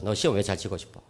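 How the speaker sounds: soft repeat. Retold or imitated. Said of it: "물었어요".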